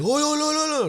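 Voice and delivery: high, panicked oh oh oh; mock panicked cries. Terrified men pleading with the neighbor, high panicked cries.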